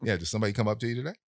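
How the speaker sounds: fast, casual question; rapid offhand question. Retold or imitated. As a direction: rush it out offhand. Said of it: "he says it just like that".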